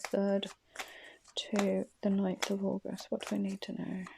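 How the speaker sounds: breathy unvoiced whisper of the dates. Real, not acted. Reader checking the dates to herself, whispered. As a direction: murmur it to yourself, no voice.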